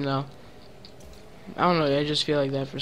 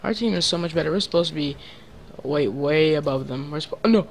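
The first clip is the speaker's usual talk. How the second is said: ordinary talk, nothing marked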